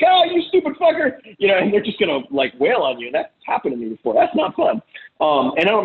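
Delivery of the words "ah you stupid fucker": much higher, a hammed-up screech of insult; imitating an angry wife lashing out; mock furious shriek. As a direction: spit it out like an enraged partner. Imitating an abusive woman yelling.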